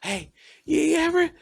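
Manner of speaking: put-on high, pleading whisper-light call to a sleeping child; a father begging his daughter for comfort at 2 a.m; father at his daughter's bed at night, high and pleading; desperate father. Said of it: "can't sit on my daughter's bed".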